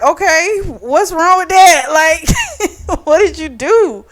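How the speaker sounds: high, laughing disbelief; amused laughing. Retold or imitated. Eric laughs at Keegan's confession.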